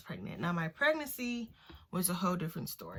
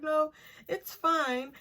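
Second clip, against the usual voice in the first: forced casual reassurance; 9 semitones higher, slower than usual. Retold or imitated. Forcing calm after awkward question.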